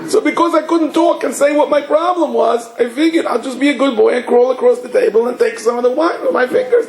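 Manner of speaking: put-on high, whiny little-boy voice; imitated crying child. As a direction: mimic a tearful child pleading his case. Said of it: "he's like crying".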